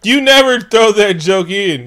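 mock outrage. Real, not acted. Scolding his cohost in mock outrage. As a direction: stretch out the protest, half joking.

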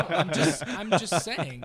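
laughing. Real, not acted. Laughing defensive backpedal.

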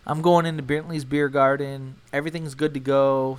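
ordinary delivery

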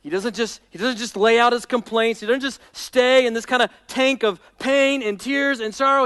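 ordinary talk

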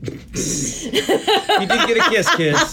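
laughing. Real, not acted. Friends mocking the angry date.

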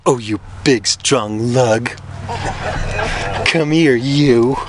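lightly voiced coaxing seductress; flirty Delilah. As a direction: coo it playfully at a big lug.

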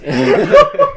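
shrieking burst of laughter; loud high laugh. Real, not acted.

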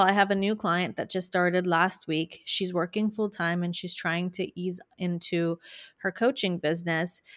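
usual speaking voice

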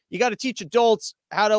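normal talking voice

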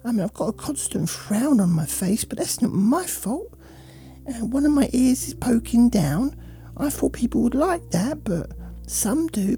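sulky, bored dog complaining about its owner; a neglected pet moaning, half whisper at times. Acted. Voicing the dog's thoughts.

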